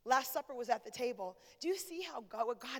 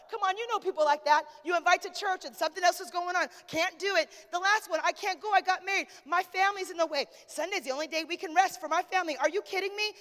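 loud, high preaching that builds to a shout; fired-up preacher driving the point home; impassioned preaching; 5 semitones higher, 11 dB louder than usual. Real, not acted. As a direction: exhort the congregation, rising to a full-voiced cry. Preaches at full force.

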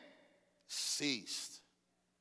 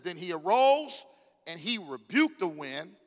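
proclaimed loudly; 3 semitones higher, 7 dB louder than usual. Acted. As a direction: swell up loud and slow on arose. Preacher reading climax aloud.

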